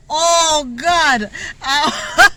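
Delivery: laughing hard. Real, not acted. Mother cracking up retelling the chicken dance, laughing hard. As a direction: struggle to talk through laughter.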